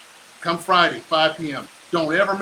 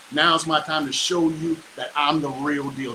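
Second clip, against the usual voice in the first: usual speaking voice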